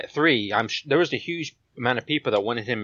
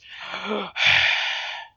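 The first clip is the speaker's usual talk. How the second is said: long breathy struggling exhale; heavy wheezy sigh; 5 semitones higher than usual. Retold or imitated. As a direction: sigh like he can barely breathe. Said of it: "you can just hear this".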